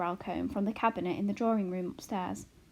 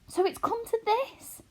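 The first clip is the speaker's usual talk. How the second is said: despairing mother; 14 semitones higher, 3 dB louder than usual. A narrator's reading. Homily despairs.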